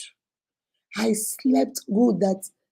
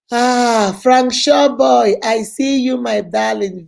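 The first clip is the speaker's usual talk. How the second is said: louder, slow hail of a name; calling out; 2 semitones higher, 7 dB louder, slower than usual. Real, not acted. Greeting a live viewer she spots, loudly calling out. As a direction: call out to a friend in the chat.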